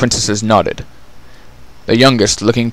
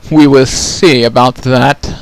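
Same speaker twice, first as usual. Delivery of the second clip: slow, louder, taunting sister voice; scornful sister; 3 semitones higher, 8 dB louder than usual. Acted. Sister mocking Chrysalis's claim, slow and scornful. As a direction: sneer at the boast.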